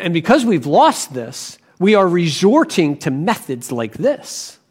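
ordinary talk

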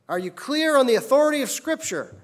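baffled official pressing the question; hammed-up angel in disbelief; flustered angel.

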